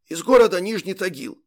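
strained husky soldier; hoarse voice. A narrator's reading. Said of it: "ответил сиплым голосом".

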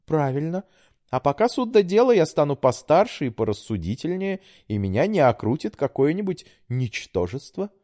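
put-on high, pleading young woman; daughter voice. A narrator's reading. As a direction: coax like a sweet daughter. Daughter voice held.

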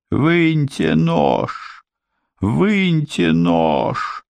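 high, slow, desperate howl; howling in pain. A narrator's reading. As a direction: wail for the knife out. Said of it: "завыл преступник".

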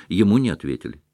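usual speaking voice

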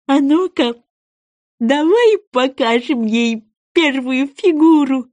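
high, slow rallying creature voice; eager goby. Acted. Goby invites sea wolf to demonstrate, eager.

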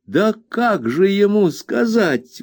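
high, slow flustered protest; a nurse objecting from under her apron; objecting Peggotty. A narrator's reading. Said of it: "возразила Пегати".

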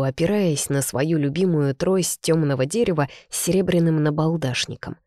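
ordinary delivery

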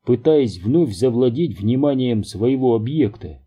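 usual speaking voice

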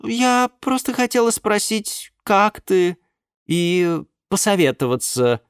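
put-on high, cooing young woman; sweet girlfriend voice. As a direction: coo it to your sugar daddy. Nymph voiced on the phone. (воркующая блондинка)

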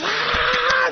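high, joyful church shout. Retold or imitated. Congregation shouting joyfully as the healed woman arrives. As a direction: shout it in praise.

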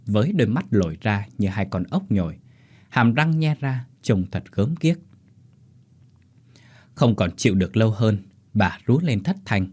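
ordinary delivery, nothing marked

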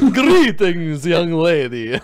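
hammed-up, loud Transylvanian vampire greeting. Game master joking as a cloaked vampire, hammed-up Transylvanian accent. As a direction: boom it out high in a mock Transylvanian accent. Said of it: "it's very Transylvanian".

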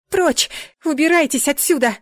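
high, loud cry to drive them off; frightened shout. A narrator's reading. Heroine to attacking demons, shouting. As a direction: yell it out in alarm.

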